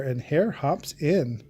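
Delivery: plain reading voice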